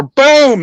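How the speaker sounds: loud high shouted boom like a rifle; mock rifle blast; gunshot boom. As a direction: bark out the shot sound. Imitating a rifle shot.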